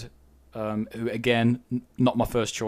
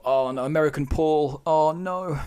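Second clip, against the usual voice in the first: higher, louder dismayed reaction; mock-dismay, pitched up and drawn toward "oh, no"; dismayed groan; 6 semitones higher, 5 dB louder than usual. Podcaster recalling his reaction to the trailer's accent, dismayed.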